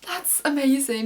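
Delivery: high, loud burst of delight; excited. Retold or imitated. Fan gushing to viewers about the finale, thrilled and loud. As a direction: gush it out, thrilled. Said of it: "And I was like".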